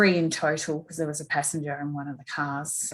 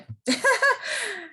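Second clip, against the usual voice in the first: teary laugh. Real, not acted. Emotional laugh while tearing up.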